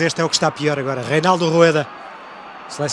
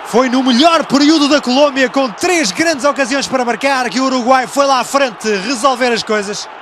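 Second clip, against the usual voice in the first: raised, animated play-by-play; excited commentary; 7 semitones higher, slower than usual. Real, not acted. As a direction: keep the goal excitement going. Post-goal commentary.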